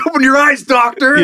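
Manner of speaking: high shouted taunt; heckler. Pretend. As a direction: yell at the doctor from stands. Heckler yelling at surgeon.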